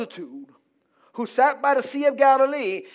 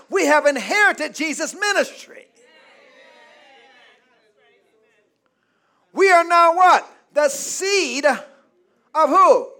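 loud, raised preacher calls; call-and-response preaching; 4 semitones higher, 6 dB louder than usual. Real, not acted. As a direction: drive the congregation to answer.

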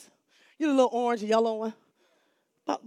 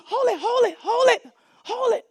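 much higher, loud urgent calls; repeated shouts; 12 semitones higher, 9 dB louder than usual. Retold or imitated. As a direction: keep yelling stop after him. Teacher trying to stop boy.

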